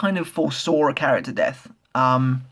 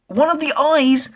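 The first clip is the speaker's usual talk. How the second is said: high, slow, squealing with disgust; 12 semitones higher, 4 dB louder, slower than usual. Real, not acted. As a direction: recoil at the gross image, voice jumping up. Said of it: "seriously, that's disgusting".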